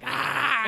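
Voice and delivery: loud imitated yowl; sudden loud animal-like cry; cat's yowl. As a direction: yell out like an angry cat. Acting out her cat's outburst.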